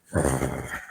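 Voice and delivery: low, soft rumbling growl imitation; deep growl. Hiker imitating what was heard in the woods, deep growl. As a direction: imitate a deep creature growl under the breath. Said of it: "this really deep growling thing".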